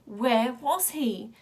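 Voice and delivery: drawn-out, hollow repeat of the question; slow eerie echo. A narrator's reading. Wild Boy hearing the question echo in his head, slow and hollow. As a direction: stretch it out like a far-off echo.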